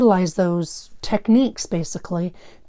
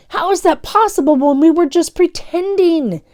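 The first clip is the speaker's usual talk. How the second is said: whimpering; 9 semitones higher, 6 dB louder than usual. Acted. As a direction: panicky scared question. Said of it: "Daniel whimpered".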